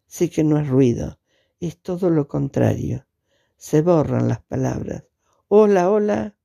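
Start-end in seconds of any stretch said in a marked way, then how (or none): 5.5-6.4 higher, raised call of hello; a raised hello hello, as if the line is cutting out. Acted. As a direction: reach for someone on a fading phone line. Calling into a bad phone line.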